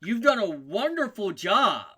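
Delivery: loud, high mock praise; over-the-top, booming compliment; gushing praise. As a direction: make a big show of congratulating the newcomer. GM acts crewmember reacting to hack.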